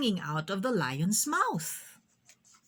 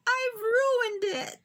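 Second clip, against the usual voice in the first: high, slow wail of dismay; much higher, drawn-out child's wail; dismayed cry; 14 semitones higher, 3 dB louder, slower than usual. Acted. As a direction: cry out as if it's all spoiled. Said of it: "she cried".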